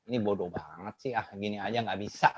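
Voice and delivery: muttered scornful thought, low and soft; a teacher's contempt said under the breath; inner thought. Retold or imitated. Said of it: "di dalam hati bilang".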